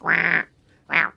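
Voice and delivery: lightly croaked frog-like call; old cat or frog. Shown as a demonstration. Teacher demonstrating throat position, old cat or frog noise. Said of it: "pretend you're a old cat or a frog".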